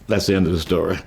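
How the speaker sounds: ordinary talk, nothing marked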